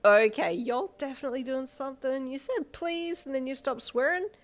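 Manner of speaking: plain reading voice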